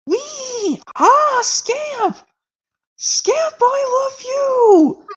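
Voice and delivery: very high, squealing cartoon voice, slow and shouted at the end; excited movie impression. Owner greeting returned puppy Scamp, squealing high and excited. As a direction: gush over the puppy at the top of the voice. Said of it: "how about this one where it goes".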